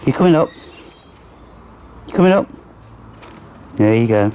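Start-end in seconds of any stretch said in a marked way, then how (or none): 0.0-2.5 raised, lilting pet talk; coaxing a squirrel to climb up; high coaxing call. Real, not acted. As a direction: sweet-talk an animal up the tree.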